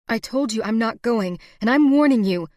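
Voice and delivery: loud, high warning; angry warning. A narrator's reading. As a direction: warn him hard. Sarah threatens Garrison.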